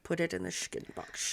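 breathy unvoiced creepy whisper. Creepy whispered movie quote.

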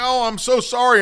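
mortified mom gushing sorry; mom apologizing. Retold or imitated. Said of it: "she's like".

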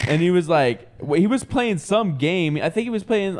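usual speaking voice

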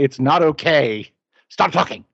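exasperated, high-pitched plea. Retold or imitated. Husband exasperated with sleeping wife.

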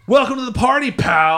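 big, high-pitched hollered greeting; shouted movie catchphrase. Retold or imitated. Podcaster hollers his drop-in gaming greeting, shouted catchphrase. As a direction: belt it out like an action-movie hero.

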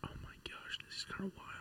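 breathy unvoiced whisper. Shown as a demonstration. Friend suggests whispering, so he whispers softly. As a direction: try out the whispering trick. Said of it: "Try my whispering technique".

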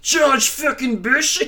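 loud, high, drawn-out screaming Scottish rant; Scottish accent. Retold or imitated. Imitating a writer's angry rant about George Bush, mock Scottish scream. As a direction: attempt a screamed Glaswegian rant, badly. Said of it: "it's in his Scottish accent".

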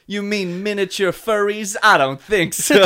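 lightly voiced John Mulaney impression; a hint of Mulaney's incredulous delivery. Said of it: "this is John Mulaney voice".

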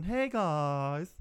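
drawn-out mock girly greeting; imitated coy favor-seeking voice; wheedling girl. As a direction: stretch it out like a girl who wants something. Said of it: "how girls come up when they want something".

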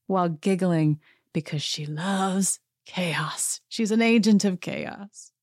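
usual speaking voice

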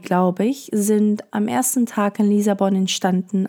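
ordinary delivery

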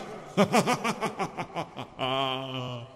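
long, high, eerie cackle; ghostly cackle. Acted. Ghost host cackles after taunt.

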